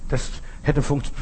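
ordinary talk, nothing marked

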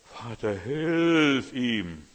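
loud, slow, drawn-out cry for help; stretched, amplified plea to the Father; loudspeaker prayer. Retold or imitated. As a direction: call it out as if through a loudspeaker. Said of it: "dann kommt es aus dem Lautsprecher".

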